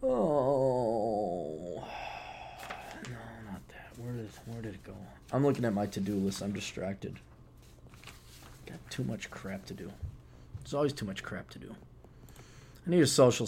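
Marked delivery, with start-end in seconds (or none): 0.0-1.8 drawn-out, sinking sigh; tired, falling moan; long weary groan. Real, not acted. Long weary sound while searching. 3.1-11.8 very soft, low talking to oneself; hushed, absent-minded grumble; distracted muttering. Real, not acted. Mutters to himself over to-do list.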